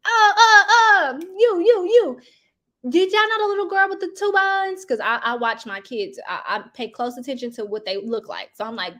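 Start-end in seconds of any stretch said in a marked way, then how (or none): 0.1-4.8 high, loud, flustered stammer; frantic calling out. Retold or imitated. Mom calling to schoolkids about her missing daughter, high frantic call. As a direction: call out to the kids in a panic, voice up high.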